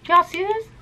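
high, eager question to viewers; excited. Real, not acted. Showing yellowing phone to camera.

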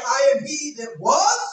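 loud, high, stretched preacher cry; climbing sermon fervor; full-force preaching. Acted. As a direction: preach it out to the whole church.

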